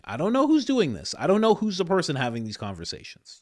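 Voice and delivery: loud, high, rushed; exasperated outburst. Real, not acted. Ranting to viewers about who pressures senators, loud and exasperated. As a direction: throw up your hands and let it out.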